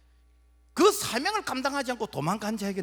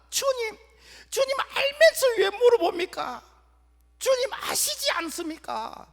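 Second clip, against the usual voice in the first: high, loud pleading with a cry breaking through; tearful Peter; 9 semitones higher, 6 dB louder than usual. Acted. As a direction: plead with the Lord through tears. Peter answering in tears.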